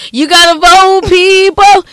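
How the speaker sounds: loud urging. Real, not acted. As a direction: shout it at the listeners. Urging listeners to vote.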